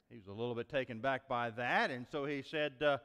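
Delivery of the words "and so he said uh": ordinary delivery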